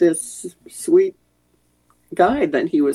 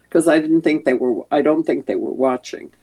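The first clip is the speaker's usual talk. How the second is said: ordinary talk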